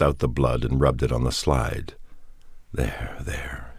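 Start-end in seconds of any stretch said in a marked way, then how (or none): 2.7-3.7 hushed and worried, barely voiced; Robert soothes frightened Ruth in an anxious whisper; gently whispered anxious comfort. A narrator's reading. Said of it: "he said anxiously".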